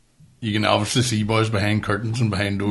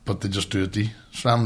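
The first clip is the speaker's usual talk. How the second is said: ordinary talk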